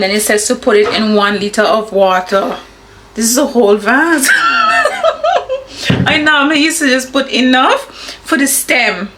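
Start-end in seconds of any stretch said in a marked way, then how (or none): 4.3-6.0 high burst of laughter; laughing. Real, not acted. Laughing at the big vase.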